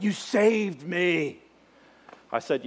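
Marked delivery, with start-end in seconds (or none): 0.0-1.4 slow, grateful; drawn-out thanks; grateful cry. Retold or imitated. Rescued rafter thanking his guide, slow grateful cry. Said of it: "he yells out".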